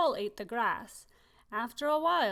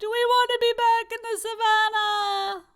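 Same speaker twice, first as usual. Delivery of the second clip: high, loud frantic scream; 11 semitones higher, 12 dB louder than usual. Acted. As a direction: cry out in panic.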